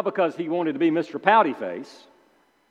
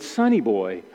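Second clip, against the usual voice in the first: put-on old-timer's sonny boy; 8 semitones lower than usual. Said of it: "Some old-timers might say".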